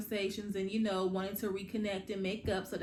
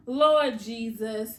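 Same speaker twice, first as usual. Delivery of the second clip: drawn-out, louder "Lord Jesus"; exasperated; 8 dB louder, much slower than usual. Real, not acted. Vlogger despairing over the characters, loud exasperated sigh. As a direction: sigh it out loudly and slowly, exasperated.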